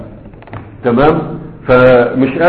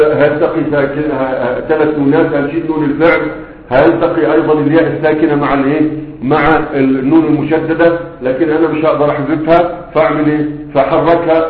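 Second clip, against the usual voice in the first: ordinary delivery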